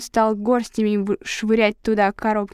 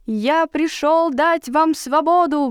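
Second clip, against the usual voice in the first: passionate proclamation; 6 semitones higher, much slower than usual. A narrator's reading. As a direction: proclaim it like a prophet. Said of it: "воскликнул дикарь".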